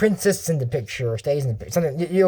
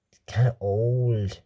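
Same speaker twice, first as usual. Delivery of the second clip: slow whisper, as if sharing a secret; whispered aside; 5 semitones lower, slower than usual. Real, not acted. Whispering to viewers that the king is old. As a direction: let it slip out under your breath.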